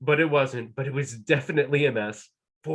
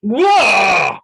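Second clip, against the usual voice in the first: loud, high startled yell; a giant woken by a rock, shouting; 8 semitones higher, 15 dB louder than usual. Acted. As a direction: jolt awake with a yelp.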